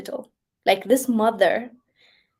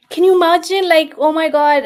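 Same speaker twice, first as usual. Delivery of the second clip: much higher and louder, appalled; loud, high, shocked exclamation; outraged disbelief; 8 semitones higher, 10 dB louder than usual. Real, not acted. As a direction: cry out in disbelief at the injustice. Outraged at stolen babies.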